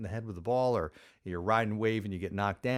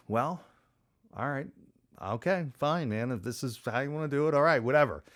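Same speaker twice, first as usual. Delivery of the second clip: sigh, then higher grudging agreement; reluctant giving in; 4 semitones higher than usual. Retold or imitated. Skier yielding to insistent friend, grudgingly resigned. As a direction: give in with a mock-exasperated "whatever".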